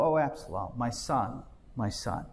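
mildly mournful, falling away on each phrase; understated lament. Retold or imitated. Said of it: "And as he wept".

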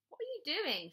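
high, mocking sing-out; teasing. A narrator's reading. Sister catching Bradley with his toys, teasing. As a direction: needle him with the question. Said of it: "she teased".

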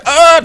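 high, loud yelp of someone hit; hammed-up cry of being struck; mock death cry. Imitating Flecha Verde killed by rays, in a mock death cry.

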